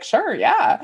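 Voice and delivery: high, eager sure yeah; gushing agreement, won over; smitten yes. Retold or imitated. Enamored shopper agreeing to the adoption, high and eager. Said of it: "I'd be like".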